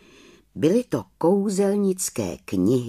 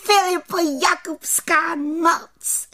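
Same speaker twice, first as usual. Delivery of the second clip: very high, rhythmic cheer; high, exultant chanting; jubilant little witch; 3 dB louder than usual. A narrator's reading. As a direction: whoop the chant out as a rejoicing witch. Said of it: "jásala malá čarodějnice".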